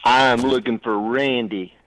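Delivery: slow Tennessee drawl; Tennessee accent. Real, not acted. Caller asks the radio hosts for Randy, slow Tennessee accent. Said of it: "I can tell by that accent".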